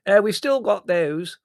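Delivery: put-on high, loud, slow old aunt. A narrator's reading. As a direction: pipe up loudly, half deaf.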